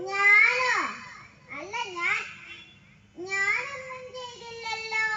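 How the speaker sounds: loud shocked squeak; startled me?. Acted. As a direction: jump at the accusation. Daughter accused by father. (ഞെട്ടലോടെ ഞാനോ എന്ന് ചോദിക്കൽ)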